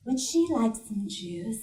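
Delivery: lightly voiced stewardess, soft and measured; quiet, polite offer amid the uproar. Stewardess asking about the screaming child, softly polite. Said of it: "the stewardess is like".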